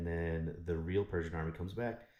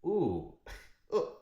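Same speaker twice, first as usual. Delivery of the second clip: mock surprise; 10 semitones higher, 4 dB louder than usual. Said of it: "And then he's like".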